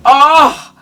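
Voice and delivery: high, loud delighted oh; amazed oh. Real, not acted. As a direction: marvel at what you see. Amazed at the opened case.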